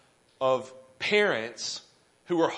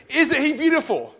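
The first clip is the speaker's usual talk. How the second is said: eager proud father; 9 semitones higher, 6 dB louder than usual. Retold or imitated. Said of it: "And he said".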